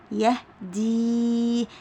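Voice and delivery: يهدي with the final ee held very long; teacher stretching the ending of يهدي; elongated final vowel. Shown as a demonstration. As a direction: sound out يهدي slowly on a steady, sustained ee. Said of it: "صوته بيكون طويل".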